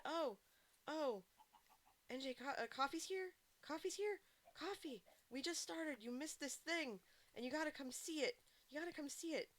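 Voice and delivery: excited imitation. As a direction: bounce with eagerness to show it off. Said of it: "was all excited about it".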